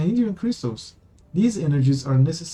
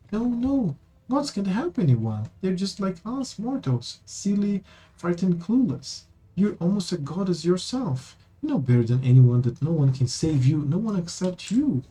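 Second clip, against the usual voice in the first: put-on higher-pitched earnest young companion pleading; Amber, earnest; 5 semitones higher than usual. Amber urging the queen to save herself, higher and earnest. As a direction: coax her gently, voice lifted.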